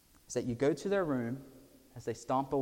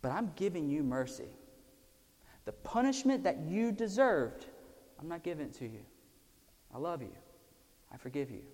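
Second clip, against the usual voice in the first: tender forgiveness; 3 semitones higher, faster than usual. Pretend. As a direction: drop your voice and forgive them. Parent forgiving child.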